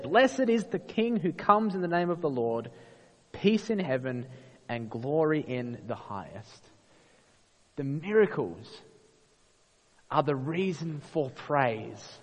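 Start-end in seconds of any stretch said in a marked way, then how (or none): none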